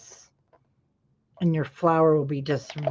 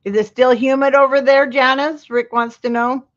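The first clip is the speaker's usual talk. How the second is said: higher, louder raised voice; calling out a question; 6 semitones higher, 6 dB louder than usual. Real, not acted. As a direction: relay the question loudly. Relays a question to Janice.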